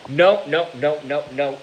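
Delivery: loud, disgusted protest, pitch jumping up. Real, not acted. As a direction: reject the idea with a loud groaning no. Disgusted reaction to comment.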